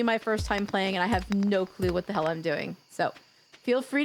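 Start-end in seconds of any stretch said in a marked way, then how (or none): none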